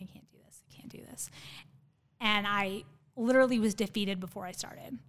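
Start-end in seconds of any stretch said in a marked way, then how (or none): 0.0-1.3 whispered self-doubt, barely voiced; inner voice. Athlete doubting herself silently on the rower, whispered inner voice. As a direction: whisper it as a private thought. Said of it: "and in my head, the whole time".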